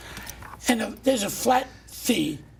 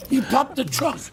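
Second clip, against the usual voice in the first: ordinary talk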